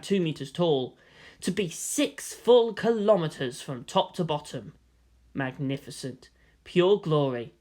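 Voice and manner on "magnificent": usual speaking voice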